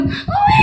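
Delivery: very high mock baby crying owe; baby wail. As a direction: wail like a newborn, high and thin.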